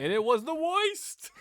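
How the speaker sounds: put-on slow, high Boston accent drawl. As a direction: lay on a thick Boston accent. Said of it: "Thanks for saying that in a Boston accent".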